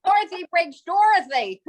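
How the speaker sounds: words tumbling out through laughter; laughing. Real, not acted. Cast member joking about breaking onstage, laughing. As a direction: tease a castmate while cracking up.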